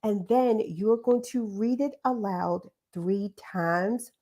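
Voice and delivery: usual speaking voice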